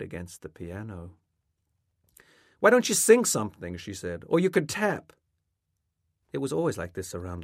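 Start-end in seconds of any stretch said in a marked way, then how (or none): 2.6-3.8 loud, high-pitched needling suggestion; goading. A narrator's reading. Mother at the piano needling her son, goading. 4.3-5.1 high, louder tossed-in suggestion; goading. A narrator's reading. Mother offering her son another act, goading.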